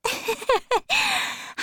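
put-on a high, light girlish laugh; Sybil laughing. Sybil laughing happily when she sees Dorian, high and light. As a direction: laugh brightly, well above the narrator. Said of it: "she began to laugh".

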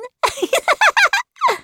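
high-pitched gleeful giggle; child's laugh. A narrator's reading. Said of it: "looked around and laughed".

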